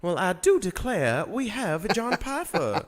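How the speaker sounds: slow, high Southern Belle drawl; Southern Belle accent. Acted. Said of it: "you missed my Southern Belle".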